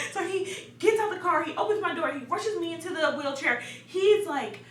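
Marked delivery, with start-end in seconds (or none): none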